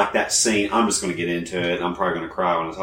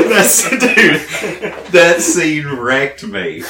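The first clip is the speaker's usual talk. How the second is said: laughing; 7 semitones higher, 5 dB louder, slower than usual. Real, not acted. Laughing while retelling.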